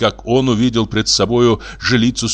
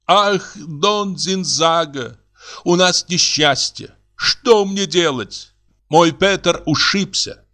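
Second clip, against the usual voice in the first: slow, high, plaintive voice; drawn-out woeful woman's voice; distraught wife; 8 semitones higher, slower than usual. Acted. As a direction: lament the misfortune helplessly. Actor's distressed wife laments.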